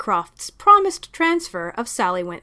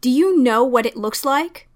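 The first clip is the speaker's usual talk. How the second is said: louder, higher furious demand; furious question; 4 semitones higher, 6 dB louder than usual. Acted. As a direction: hurl the question at him. Sally furious at Lestrade.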